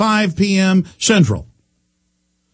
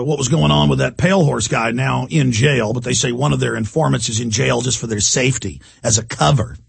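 ordinary delivery

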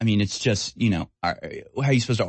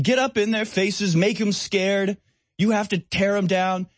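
mock screaming quote; 8 semitones higher than usual.

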